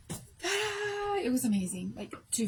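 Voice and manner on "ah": high sigh of relief; relieved ah. Retold or imitated. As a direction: let out the relief in one breath. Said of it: "And I was like".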